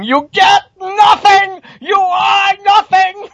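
high, drawn-out full-throated scream; Gene Wilder screaming. Retold or imitated. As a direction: shriek each word out long, an all-out movie tirade. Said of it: "a Willy Wonka's chocolate factory screaming".

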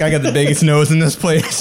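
boasting through a laugh; mock swagger with a laugh; cocky boast. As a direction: strut and brag, laughing. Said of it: "he walked in there like".

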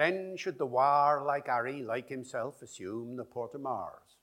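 OP Shakespeare with the R in "warlike" sounded; original pronunciation. Shown as a demonstration. Linguist answers the RP reading in original pronunciation. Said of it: "an example of R P versus O P".

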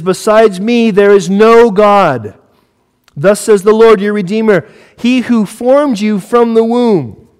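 slow, high, ringing declaration; forceful scripture proclamation. Acted. Preacher reading God speaking through Isaiah, forceful proclamation. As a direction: proclaim it with weight, stretching the key words.

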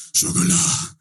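sjokolade breathed out as a loud whisper. Shown as a demonstration. Testing the word on locals as a whisper. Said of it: "i alle mulige tonefall".

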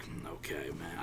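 quietly stung; deflated reply. Retold or imitated. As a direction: swallow the insult. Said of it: "And I was like".

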